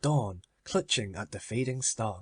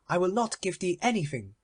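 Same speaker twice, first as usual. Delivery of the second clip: higher, proud miser voice; Avarice's voice; 6 semitones higher than usual. Acted. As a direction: raise the pitch, possessive and cold. Avarice refuses Death.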